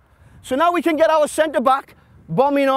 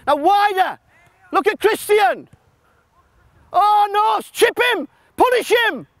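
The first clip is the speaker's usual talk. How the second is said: high, loud touchline yell; sideline shout; 9 semitones higher, 3 dB louder than usual. Real, not acted. Coach yelling at players during a drill, loud. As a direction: urge them on from the sideline.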